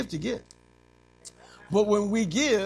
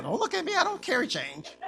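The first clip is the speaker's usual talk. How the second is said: mock cashier brush-off; 8 semitones higher than usual. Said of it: "They like".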